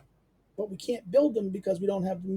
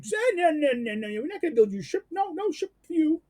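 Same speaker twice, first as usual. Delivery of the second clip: a hint of a put-on foreign voice, higher; lightly voiced foreign supplier saying no; mock foreign refusal; 7 semitones higher than usual. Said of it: "you get some Indian or Chinese guy saying to you".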